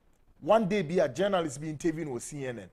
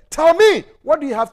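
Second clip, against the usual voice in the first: higher, louder challenge; loud demand; 7 semitones higher, 7 dB louder than usual. Real, not acted. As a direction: demand an answer. Ranting challenge.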